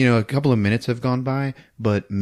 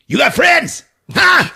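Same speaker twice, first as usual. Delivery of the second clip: loud, high demanding shout; threatening shout; 6 dB louder than usual. Acted. Bum demands an answer from Jennifer, loud high shout. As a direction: bark the question at the hostage.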